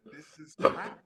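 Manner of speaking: harsh verdict. Retold or imitated. Teacher tearing apart my drawings.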